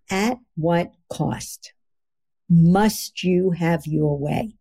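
slow, weighty emphasis on each word; slow emphatic. Shown as a demonstration. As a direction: lay out three words slowly and deliberately. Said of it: "is three words".

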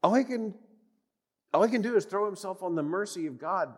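normal talking voice

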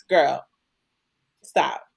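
ordinary talk

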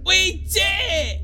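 high, drawn-out victory yell; triumphant shout. Acted. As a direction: cheer it loud and high, stretching the words. Celebrating unification.

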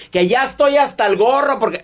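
high-pitched angry interruption; mock yelling. Doctor imitating the quarrelsome person cutting in, mock yelling. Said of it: "estás gritando".

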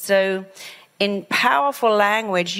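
ordinary delivery

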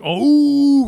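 squeaky high mocking oh; mock surprised oh. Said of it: "they're like".

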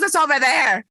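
mock aggressive shout; pissed-off nurse. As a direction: imitate a nurse annoyed at being asked. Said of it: "she was pissed that I had asked".

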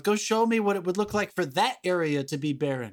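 ordinary talk, nothing marked

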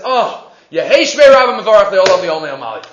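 loud retold outcry of praise; loud, exclaimed recitation; crying out. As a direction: call it out loudly, like an exclaimed response. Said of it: "What's he screaming out Yehei Shmei Rabba for?".

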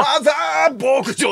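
high, drawn-out cheerful title call; cheery announcement. Retold or imitated. Comedian imitating a cheerful on-air news intro, high and bright. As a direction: sing out the place name brightly. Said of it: "明るくニュースが出てたけど".

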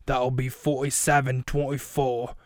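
slow, put-on deli man voice; slow character impersonation at the till; Jason Momoa. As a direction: draw out the price in character. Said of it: "Jason Momoa says".

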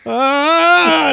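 high, drawn-out "ah" of helplessness; mock panicked cry. Trainer reenacts his reaction to a crying client, mock panicked cry.

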